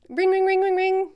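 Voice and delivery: phone ring imitation.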